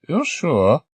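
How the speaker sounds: put-on high, quavering old woman's voice; elderly lady. Old lady volunteering to stay behind, high old voice. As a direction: play an eager elderly lady.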